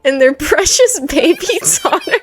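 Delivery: high voice breaking into giggles; laughing while reading. Real, not acted. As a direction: crack up mid-sentence as you read. Cracks up while reading narration.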